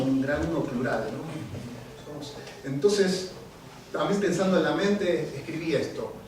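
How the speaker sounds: usual speaking voice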